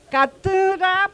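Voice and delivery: katura said slowly, syllable by syllable, stressing the second syllable; slow stressed demo. Shown as a demonstration. Teacher showing students where katura's stress falls, slow. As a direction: spell out katura slowly for the class. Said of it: "debe jalar el acento de pronunciación, la fuerza".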